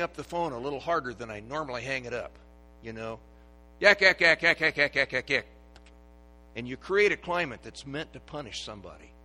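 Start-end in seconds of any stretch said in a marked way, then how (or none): 3.8-5.5 loud yakking that fades off; a hammed-up gossip's yak yak; mock nagging chatter. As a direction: mimic people nattering on.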